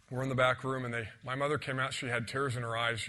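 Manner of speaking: ordinary talk, nothing marked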